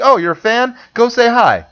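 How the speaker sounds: breezy and inviting; friendly handler. Retold or imitated. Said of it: "Instead of being like".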